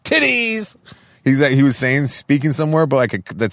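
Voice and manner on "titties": high, exclaimed soundbite imitation; Trump. Cutting in with a favorite soundbite, imitated high exclamation. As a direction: blurt it out high like the clip. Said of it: "every time they play the Trump".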